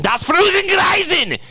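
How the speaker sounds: high, drawn-out German shout. Acted. Host shows the cartoon version of German, shouted German accent. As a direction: bellow it in caricature German. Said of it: "like doing a German instead of doing".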